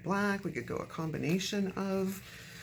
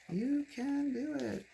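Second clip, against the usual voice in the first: encouraging; 9 semitones higher, slower than usual. Pretend. Coaxing the paper into place.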